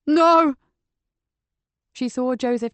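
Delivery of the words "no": loud, high cry of NO; desperate shout. Acted. Patrick shouting to keep the inspector out. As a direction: shout to stop her coming in.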